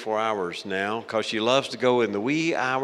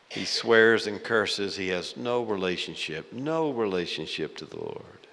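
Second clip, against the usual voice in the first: usual speaking voice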